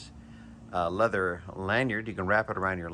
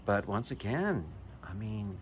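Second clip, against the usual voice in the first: ordinary talk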